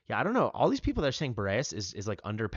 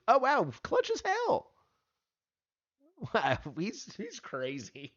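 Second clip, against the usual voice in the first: real amazement, much higher and laughing; amazed; 13 semitones higher, 4 dB louder, slower than usual. Real, not acted. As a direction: cheer the clutch moment in disbelief. Hero's clutch play amazes streamer.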